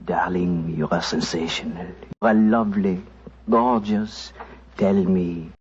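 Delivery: put-on low hushed French accent, half-whispered; a mock French charmer's low French accent; fine French accent. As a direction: woo her in a pretend French accent, pitched low. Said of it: "whispering in my fine French accent".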